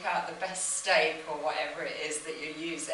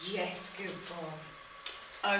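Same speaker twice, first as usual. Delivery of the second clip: soft praise; 5 dB quieter, much slower than usual. Real, not acted. Praising the dog softly.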